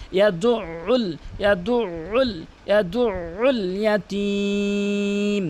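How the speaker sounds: "ইয়াদুল" slow, leaning hard on the throat ayn; pressed tashdid. Shown as a demonstration. Teacher demonstrates tashdid, pressed ayn. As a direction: press and hold "ইয়াদুল", stretch "ইয়াতীম". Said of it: "ভর দিয়ে তাসদীদের উচ্চারণ".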